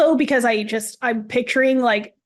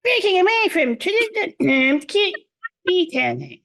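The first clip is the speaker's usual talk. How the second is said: high, gibberish-like Minion chatter; lightly voiced Minion babble; 6 semitones higher than usual. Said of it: "Can you do the Minion voice for me?".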